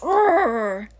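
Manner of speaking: playful growled roar imitating the dog; a mock little dog roar. Imitating Dreamy roaring in other dogs' faces. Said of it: "gets right in their face and goes".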